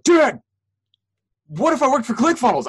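thrilled son calling his dad with big news; excited. Retold or imitated. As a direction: blurt it to dad, can't contain it.

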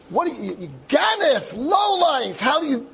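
high-pitched, sputtering tirade; screaming like a maniac. Retold or imitated. Accuser berating the cyclist before a huge crowd, screaming. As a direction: rant it at a high pitch, tripping over the words. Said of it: "this fellow screaming like a maniac".

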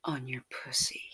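ordinary delivery

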